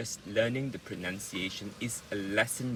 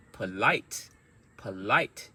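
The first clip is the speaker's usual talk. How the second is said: Polite repeated, LITE punched and rising; short first syllable, strong second in Polite; word stress demo; 4 dB louder, slower than usual. Shown as a demonstration. Teacher drills Polite for learners, stressed on LITE. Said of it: "those sounds are the strong sounds".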